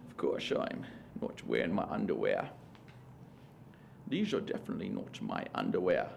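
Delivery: put-on slushy Scottish accent, halting phrases; Sean Connery as Rain Man. Rain Man fretting about his underwear, Scottish slushy delivery. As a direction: slur the s sounds like a Scottish film star. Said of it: "Sean Connery as, uh, Rain Man".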